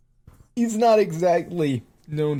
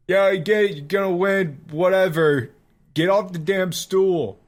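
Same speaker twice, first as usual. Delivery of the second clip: higher-pitched dismissive heckle; fed-up bar patron; impatient drinker heckling; 4 semitones higher than usual. Acted. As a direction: brush off the speaker from the crowd. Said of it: "the guy who finished his drink".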